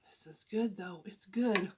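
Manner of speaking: whispered praise of the taste. Real, not acted. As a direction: whisper it to yourself. Tasting quietly.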